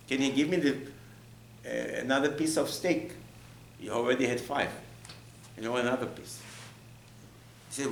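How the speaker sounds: ordinary talk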